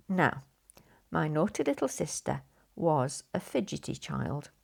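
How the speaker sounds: usual speaking voice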